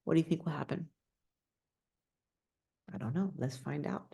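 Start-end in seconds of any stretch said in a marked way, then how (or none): none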